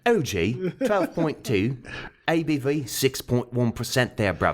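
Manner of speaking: lightly put-on English accent reading the label; a hint of English accent, joking; mock English accent. Host reads the beer label in a mock English accent. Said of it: "Kinda, yeah".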